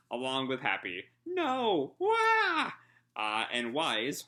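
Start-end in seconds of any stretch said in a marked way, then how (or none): 1.3-2.7 very high, loud cartoon wail; shrill comic shriek; panicked scream. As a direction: shriek as the tentacle grabs you. Rebecca grabbed by tentacle.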